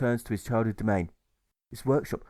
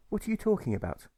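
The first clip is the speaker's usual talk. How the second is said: Alfred asking; 10 semitones higher than usual. A narrator's reading. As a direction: sound baffled. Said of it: "Alfred asks him".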